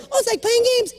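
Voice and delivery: put-on very high girlish voice; squeaky little girl voice. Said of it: "And then the little girl".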